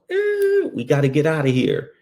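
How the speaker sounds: sudden high panicky yelp; startled partner bolting, voice jumping up; panicked partner. Retold or imitated. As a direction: blurt it out in a fright. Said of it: "He goes".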